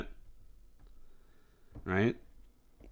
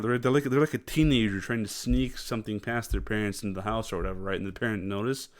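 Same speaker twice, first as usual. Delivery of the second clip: ordinary talk, nothing marked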